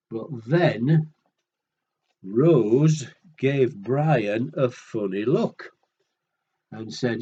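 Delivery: ordinary delivery, nothing marked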